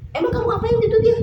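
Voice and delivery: very high, loud retold demand; incredulous questioning. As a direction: imitate an alarmed husband's questioning. Husband questions wife about child.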